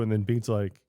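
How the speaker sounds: ordinary talk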